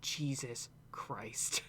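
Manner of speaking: unvoiced, slow whisper; whispered aside. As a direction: mutter it under your breath in shock. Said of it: "I was like".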